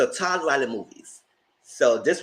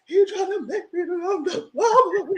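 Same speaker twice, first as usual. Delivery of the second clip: much higher, slow, laughing tearful whine; mock crying; 4 dB louder, slower than usual. Friend imitates the star crying about her boyfriend. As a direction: whimper it through laughs. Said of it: "that's when she started crying".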